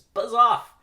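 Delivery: sudden high shouted punchline; headline yell. Acted. Newspaper headline read out as a shout.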